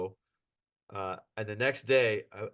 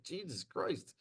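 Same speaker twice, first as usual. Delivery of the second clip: quiet disbelief; 5 semitones higher, 10 dB quieter, slower than usual. Retold or imitated. Stunned quiet reaction.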